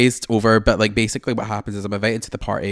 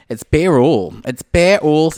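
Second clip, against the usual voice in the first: sighed then bursting louder and higher; sigh, then blurt it out loud and high; flustered cry after a sigh; exasperated sigh; 7 semitones higher, 8 dB louder, slower than usual. Real, not acted. Laughing banter over bare all.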